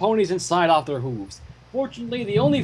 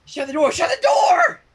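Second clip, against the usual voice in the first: frantic shout, climbing higher on the repeat; yelling; 4 dB louder than usual. Acted. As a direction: scream it at someone to slam the door shut. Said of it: "she yelled".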